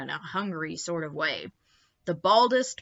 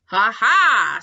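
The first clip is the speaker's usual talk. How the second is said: loud mocking laugh; gloating laugh; 4 semitones higher, 12 dB louder than usual. A narrator's reading. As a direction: crow in triumph. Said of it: "said several globby people".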